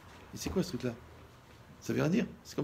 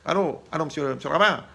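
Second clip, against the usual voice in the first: lightly raised, louder anxious husband on the phone; caller voice; 4 semitones higher, 9 dB louder than usual. Retold or imitated. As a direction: play the worried caller ringing the rabbi. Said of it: "comme un sketch".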